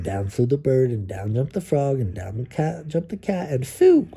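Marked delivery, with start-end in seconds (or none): 3.7-4.1 dog's triumphant yelp, very high; loud high yelp. Acted. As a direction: bark it out like a proud dog.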